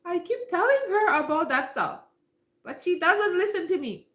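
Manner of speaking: husband complaining that his wife won't listen; imitating the husband. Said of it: "He was saying".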